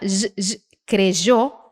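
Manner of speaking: "creyó" with a buzzing zh, kre-ZHOH. Shown as a demonstration. Teacher showing own option, "creyó" with French-like zh for y. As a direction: buzz "j, j", then put that zh in "creyó". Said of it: "Ele pode ter o som de J".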